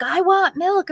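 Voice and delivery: put-on high whiny-demand little kid voice; demanding child. Son calling from his room for milk, high demanding child. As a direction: imitate a toddler demanding something.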